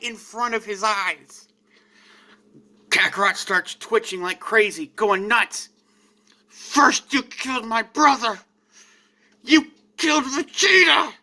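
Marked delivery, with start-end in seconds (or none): 6.5-11.1 furious rage. Acted. Kakarot going nuts with rage.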